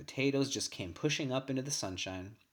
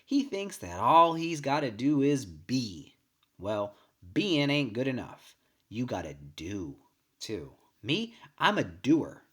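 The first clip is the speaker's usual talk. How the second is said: louder, slower, scolding and emphatic; mother's stern voice; 6 dB louder, slower than usual. A narrator's reading. Mother lecturing her children, slow and forceful. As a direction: lay down the law like a busy farm mother. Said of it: "Mom would say to us later".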